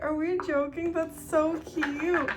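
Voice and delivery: high, drawn-out so cute; gushing over a mermaid on the cocktail; gushing disbelief. Retold or imitated. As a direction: gush in slow delight. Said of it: "But I was like".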